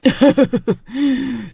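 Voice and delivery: evil witch laugh; witch cackle. Acted. Witch cackles after taking eyes. (चुड़ैल की डरावनी हँसी)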